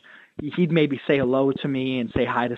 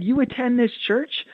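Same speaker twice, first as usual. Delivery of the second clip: much higher, bright eager hello; excited greeting; 11 semitones higher than usual. Retold or imitated. Pastor retelling how he greeted an eager churchgoer, excited and high. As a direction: greet him like you're thrilled to meet him.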